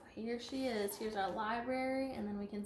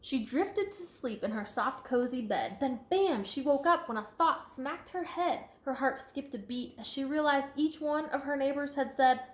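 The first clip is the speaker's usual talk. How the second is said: lively, sing-song storybook read; reading to children; 3 semitones higher, 8 dB louder than usual. A narrator's reading. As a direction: read it out to a class with bounce. Reading picture book aloud.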